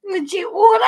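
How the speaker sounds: incredulous laughing shout; laughing disbelief. Real, not acted. Incredulous at the streamer's claim.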